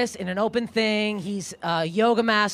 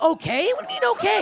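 incredulous; 10 semitones higher than usual. Acted. Woman challenging his sudden okay, incredulous. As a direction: throw it back in high-pitched disbelief.

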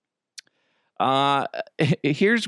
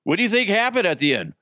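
locker-room interviewer; 7 semitones higher, faster than usual. Acted. Host plays locker-room interviewer.

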